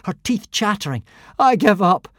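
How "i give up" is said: shouting. A narrator's reading. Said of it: "Hiccup shouted back".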